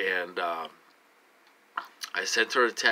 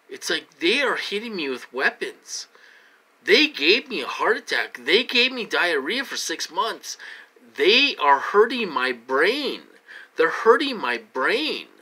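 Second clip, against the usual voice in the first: louder, pressed and urgent; pleading to be believed, voice climbing; distressed, rising voice; 6 semitones higher, 4 dB louder than usual. Real, not acted. Distressed recounting of torture.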